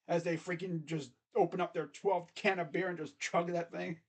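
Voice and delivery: normal talking voice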